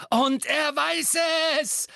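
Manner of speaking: high, drawn-out yell; taunting shout. A narrator's reading. Harry taunting Bellatrix about her master, shouting. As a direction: call it out to goad the enemy.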